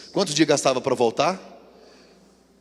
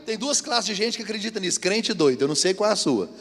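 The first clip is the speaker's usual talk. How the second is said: ordinary delivery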